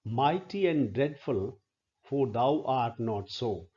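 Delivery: "Mighty and dreadful" read slowly with marked beats; slow metrical recitation. Shown as a demonstration. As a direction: scan the line aloud, giving each beat its weight. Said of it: "Mighty, two syllables".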